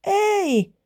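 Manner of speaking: put-on very high child's call; high, squeaky little-girl voice; little girl shouting back. A narrator's reading. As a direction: pipe it down from up high. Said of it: "pikku Iida huusi".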